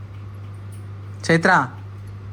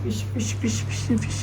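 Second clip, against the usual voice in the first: soft, higher coaxing repeats; soft sing-song prompting; 4 semitones higher, 9 dB quieter than usual. Real, not acted. Coaxing toddler to say fish.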